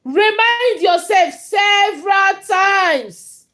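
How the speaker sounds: loud, high, slowed-down emphatic call; emphatic shout. Real, not acted. Coach urging listeners, loud and slow. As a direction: drive the words home loudly. Motivational talk, shouted opener.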